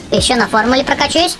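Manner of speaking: usual speaking voice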